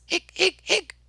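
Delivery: high, sharp hiccup sounds; little squeaky hics; baby's hiccups. Acted. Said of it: "Baby Betsy got hiccups".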